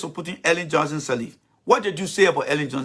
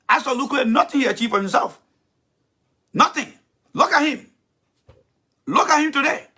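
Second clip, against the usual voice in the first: scornful tirade; 9 semitones higher than usual. Real, not acted. Berating Thomas, pitch held high.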